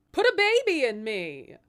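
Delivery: loud, high tipsy plea; tipsy queen demanding a baby, loud; drunk demand. Acted. Drunk queen begging beggar woman.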